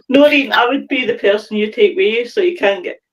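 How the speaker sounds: normal talking voice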